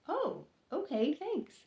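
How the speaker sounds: high, tentative, a little unsure; puzzled thanks. Retold or imitated. Clerk taking a mystery bag from her coworker, bemused. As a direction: thank him while not understanding.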